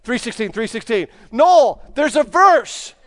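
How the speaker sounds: calls out high and loud across a gym; trainer yelling across the gym. Retold or imitated. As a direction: shout it across the room, puzzled and excited. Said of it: "the trainer from the other side of the gym yelled".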